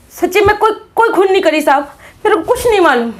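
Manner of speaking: high-pitched, desperate pleading of innocence; panicked denial. Acted. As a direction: protest frantically to the officer, voice pushed way up. Accused pleads innocence under interrogation. (घबराकर ऊँची आवाज़ में गिड़गिड़ाते हुए)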